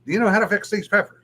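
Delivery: a hint of a bossy shopkeeper rattling it off; brisk old lady. Old lady quizzing a clueless shopper, brisk and high. Lady quoted, higher and faster.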